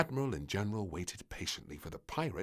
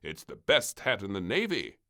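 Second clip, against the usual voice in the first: warning admiral; 7 dB louder than usual. Acted. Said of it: "he warned".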